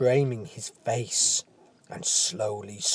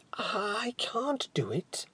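frightened whisper; 9 semitones higher, 8 dB quieter, slower than usual. Acted. Leo panics at the bars.

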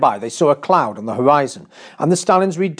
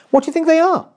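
put-on high, eager boy; 4 dB louder, much faster than usual. A narrator's reading. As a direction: ask it high and curious. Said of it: "asked Tim".

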